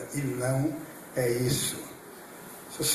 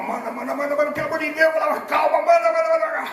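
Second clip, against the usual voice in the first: high, loud desperate begging for the drug; desperate pleading; 11 semitones higher, 4 dB louder, faster than usual. Retold or imitated. Begging the nurse for painkillers, desperate pleading cry. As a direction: beg for it through the pain, climbing to a cry.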